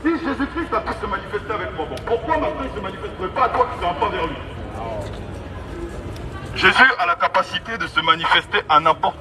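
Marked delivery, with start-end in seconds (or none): none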